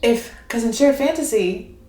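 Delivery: normal talking voice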